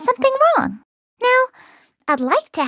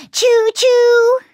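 train whistle choo-choo; 8 semitones higher, 5 dB louder than usual. Acted.

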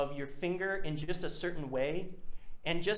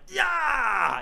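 high, loud yelled "yeah" falling away; harsh roll imitation; 10 semitones higher, 5 dB louder than usual. Shown as a demonstration. Teacher showing how a roll should not sound, high yell. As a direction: blare it out as the wrong sound. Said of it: "It should not sound like".

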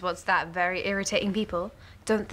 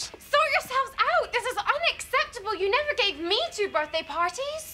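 angry outburst; 11 semitones higher than usual. Acted. Daughter exploding at parents over parties, high indignant outburst. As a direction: explode at them, voice pitched right up.

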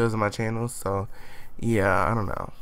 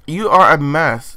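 slow, drawn-out louder sass; sassy scold; 4 semitones higher, 8 dB louder, slower than usual. Pretend. Gamer voicing her Sim insulting another, slow and sassy. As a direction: drag out each word with attitude.

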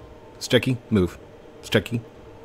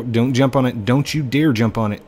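ordinary talk, nothing marked

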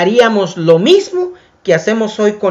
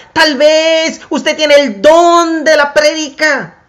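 fervent preaching; 7 semitones higher than usual. Acted. Preacher urging his congregation to find their gift, emphatic. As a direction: drive home each gift to the congregation.

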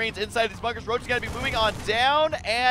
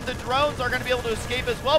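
ordinary talk

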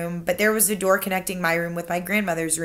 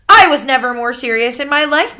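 higher, louder grandmother voice; accusing grandmother; 5 semitones higher, 9 dB louder than usual. Acted. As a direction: pin the blame on him. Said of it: "my grandmother said".

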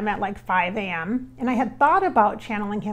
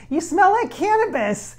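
amused; 8 semitones higher than usual. Real, not acted. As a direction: blurt it out with a grin, laughing. Laughing at spirit's scent.

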